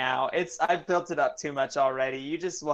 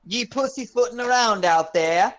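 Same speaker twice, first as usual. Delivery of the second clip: high, loud hammed-up Australian; mock Australian accent; 7 semitones higher, 6 dB louder than usual. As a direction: tease in an exaggerated Australian accent. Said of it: "do an Australian accent".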